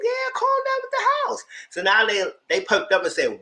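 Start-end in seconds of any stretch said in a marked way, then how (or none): 0.0-1.4 very high squeaky mock voice; high falsetto telling them to calm down; mock reply. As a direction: squeak it out sarcastically. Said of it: "I was like".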